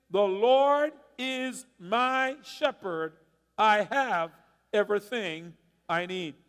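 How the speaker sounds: very slow, measured call to recite together; slow unison recitation. Shown as a demonstration. Pastor leads congregation reciting the psalm aloud, slow and measured. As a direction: lead the church word by word. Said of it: "You wanna say that with me today?".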